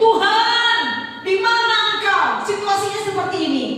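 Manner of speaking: loud, high desperate cry; crying out to God. Pretend. Believer crying out to God, loud and high. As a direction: call out to heaven in distress. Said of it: "kalau kita teriak".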